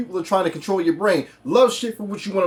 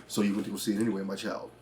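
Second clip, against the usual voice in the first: low, much softer, ominous voice; menacing Thanos; 9 semitones lower, 12 dB quieter than usual. Acted. Thanos behind him in the theater, low menace. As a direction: murmur it low like a looming tyrant.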